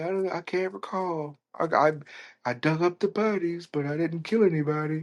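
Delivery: a coy killer pleading innocence; evasive Ed. Retold or imitated. Voicing Ed denying killings.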